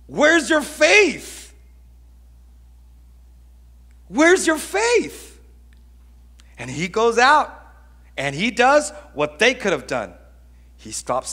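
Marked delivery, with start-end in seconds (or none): 0.1-5.4 loud, high, drawn-out exasperated scolding; indignant attitude. Acted. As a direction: shout the question as an annoyed rebuke. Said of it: "wakes up with some Nazareth attitude".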